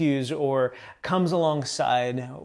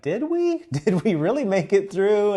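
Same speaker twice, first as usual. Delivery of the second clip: high-pitched, disbelieving question; doubtful wife; 6 semitones higher, 4 dB louder than usual. Retold or imitated. Worn-out wife questioning her husband about the first day, doubtful. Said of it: "she's like".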